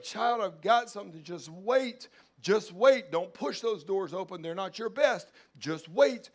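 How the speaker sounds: God calling back to the frustrated believer, loud; preached shout. Acted. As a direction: proclaim it at full force. God answering loudly in preaching.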